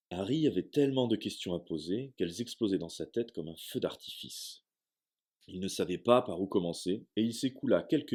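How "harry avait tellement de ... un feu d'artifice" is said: plain reading voice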